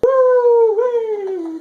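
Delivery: very high, loud whoop; excited whoop. Acted. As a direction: whoop with joy.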